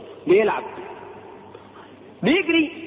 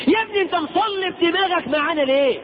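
exasperated rebuke; 8 semitones higher than usual. Real, not acted. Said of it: "rebuking a stubborn listener".

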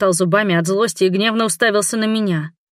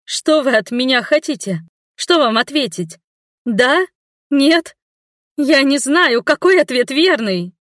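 higher, louder tearful pleading; blubbering; 6 semitones higher, 3 dB louder than usual. A narrator's reading. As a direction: sob the questions out in panic. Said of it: "сквозь слёзы выпалила я".

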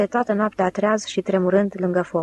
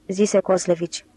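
ordinary delivery

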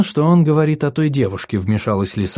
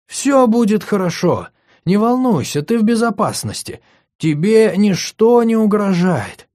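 soothing reassurance; 10 semitones higher than usual. Acted. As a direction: lull him to calm. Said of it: "ласково окутала".

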